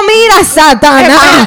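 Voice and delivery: loud, high, drawn-out exclamation; mock rebuke. Woman mock-rebuking her ex as Satan, loud outrage. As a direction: cry it out in comic outrage.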